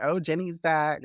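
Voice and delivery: high, drawn-out happy exclamation; delighted. Retold or imitated. Fan greeting a returning character, delighted.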